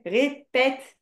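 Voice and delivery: "répète" slowly split, ré-pète; correct pronunciation. Shown as a demonstration. Teacher confirms the pupil's answer, demonstrating syllable by syllable. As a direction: sound out "répète" in two separate beats. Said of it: "Pas répéter".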